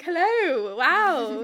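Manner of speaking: big, high British hello; imitated British accent. Woman retells his welcome on the app, exaggerated British accent. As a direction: exaggerate a loud British greeting. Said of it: "his, like, accent be like".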